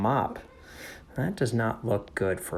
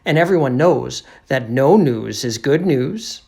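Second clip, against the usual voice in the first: loud, emphatic declaration; Detective McGarrigan; 2 semitones higher, 12 dB louder than usual. Acted. Detective explains her logic to narrator, assertive. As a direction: state it as obvious fact. Voicing detective's answer in story.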